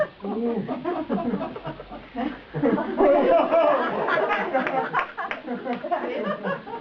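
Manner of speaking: long, high, delighted laugh; high burst of laughter. Real, not acted. Face reader laughing at her client's surprise, delighted laugh. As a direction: let a big laugh ring out.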